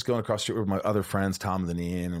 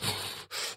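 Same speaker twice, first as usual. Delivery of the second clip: startled flinch breath; 10 dB quieter than usual. Retold or imitated. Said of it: "And he goes".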